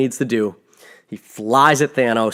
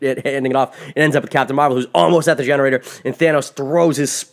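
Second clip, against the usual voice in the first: ordinary talk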